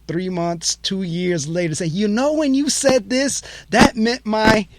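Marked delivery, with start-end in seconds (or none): 1.9-4.7 high, slow, eager caller's voice; imitating a client. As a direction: play a client who suddenly gets it. Clients calling back much later.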